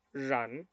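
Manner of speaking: "Run" with an untrilled, zh-like R; correct Mandarin R. Shown as a demonstration. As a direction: buzz the R of "Run" through a narrowed mouth. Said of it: "se dice run".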